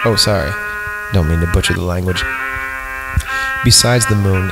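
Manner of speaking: ordinary talk, nothing marked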